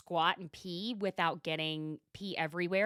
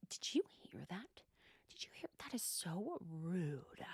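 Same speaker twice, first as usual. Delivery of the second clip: whisper; 10 dB quieter than usual. Retold or imitated. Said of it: "she goes".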